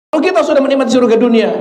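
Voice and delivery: fast, high exhortation; impassioned preaching. Real, not acted. Preacher promising paradise to the congregation, impassioned.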